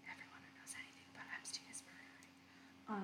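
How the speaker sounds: very soft whispered aside; conspiratorial whisper. Pretend. Host slips in a theory about Epstein, conspiratorial whisper. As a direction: whisper it like a dangerous secret.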